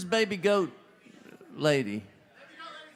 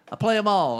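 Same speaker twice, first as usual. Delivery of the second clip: "I play 'em all" said as a bold, louder boast; cocky boast; 4 semitones higher, 5 dB louder, faster than usual. Retold or imitated. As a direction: brag it like you know every song. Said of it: "I went".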